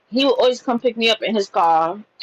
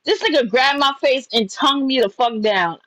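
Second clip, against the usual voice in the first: ordinary talk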